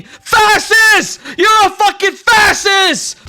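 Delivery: high, loud furious shout; booing protester yelling insults; angry heckler. Acted. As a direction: scream it at him like a protester. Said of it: "Others are booing and saying".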